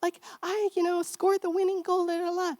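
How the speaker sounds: put-on high, eager kid voice; a child bursting to tell news; excited child. Shown as a demonstration. Child home from school telling mommy about the goal, excited. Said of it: "they're like".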